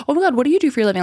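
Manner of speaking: sugary sarcasm; mock gushing question. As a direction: gush fake interest at a troll. Mock-praising haters online.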